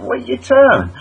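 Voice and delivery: irritated driver. Retold or imitated. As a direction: snap it at the driver. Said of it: "I'm like".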